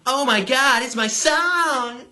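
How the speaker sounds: high-pitched squealing party fan; mock giddy squeal ending in a tee-hee; mock excited scream. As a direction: squeal it like a giddy party girl, drawing out the words. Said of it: "and they scream".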